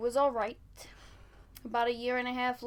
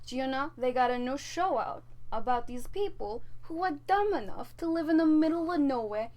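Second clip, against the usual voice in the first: ordinary talk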